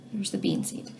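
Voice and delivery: whispered. Real, not acted. Showing the seed to children.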